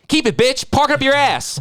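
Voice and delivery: high-pitched, punchy movie-line imitation; mock brash retort. Retelling the toll zone scene, mock brash retort. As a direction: throw the insult out high and brash.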